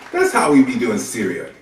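ordinary talk

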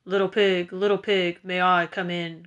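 wolf voice calling at the door; big bad wolf. A narrator's reading. Wolf calls to the first pig from outside, menacing wolf voice. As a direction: play the big bad wolf, asking to come in. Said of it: "the voice of the big bad wolf".

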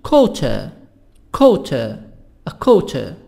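British "Quarter" with crisp T and no R; British accent. Shown as a demonstration. Teacher contrasting accents, British "Quarter" without R sounds.

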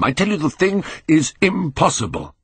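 high-pitched, vehement insistence; agitated, high-voiced protest; frantic denial. A narrator's reading. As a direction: insist on it, pitch climbing in disbelief. Frantic repeated denial of the truth.

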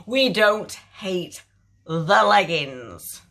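very slow and loud, split into chunks; emphatic repeat. Real, not acted. Vlogger repeating her leggings verdict to viewers, slow and emphatic. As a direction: spell out the verdict with drawn-out weight.

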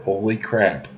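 normal talking voice

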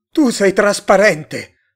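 loud, high, slow cry; stretched-out yell of shock; astonished shout. A narrator's reading. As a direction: shout it in disbelief. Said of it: "gridò Tomás".

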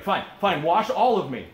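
loud, over-eager giving in; Peter. Acted. Disciple to Jesus, going to the other extreme, emphatic.

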